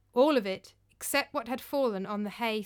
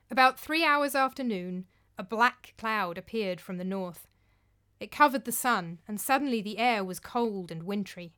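ordinary delivery, nothing marked

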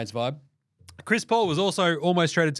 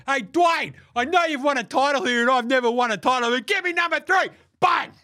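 high, loud mocking whine of a needy star; mocking Chris Paul, pitched up and loud; 9 semitones higher, 4 dB louder than usual. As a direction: ham up a pushy player begging. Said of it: "He's like".

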